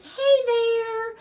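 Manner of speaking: put-on high squeaky baby talk to a puppy face; cute greeting. Cooing at a puppy print on the towel in a high voice.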